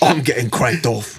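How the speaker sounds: put-on laughing Australian accent; Crocodile Dundee accent. Friend laughs through the tiebreaker line in an Australian accent. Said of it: "back to Crocodile Dundee".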